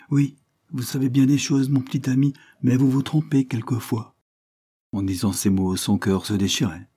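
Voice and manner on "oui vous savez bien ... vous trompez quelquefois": put-on higher-pitched reply to a child; gentle governess in dialogue. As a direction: voice the governess answering the boy, raised above narration.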